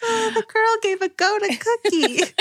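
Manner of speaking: put-on cutesy child voice. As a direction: coo it like a tiny girl reporting news. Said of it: "That is so delightful".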